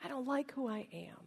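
very soft, subdued confession; quiet admission. Acted. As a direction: let it sink in quietly. Repeats friend's cry as quiet reflection.

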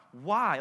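"Why?" set apart as an underlined word; stress on Why. Acted. Ruth questioning Boaz's favor, stress on "Why?". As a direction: lean on a lone, drawn-out "Why?". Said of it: "Like, underline why".

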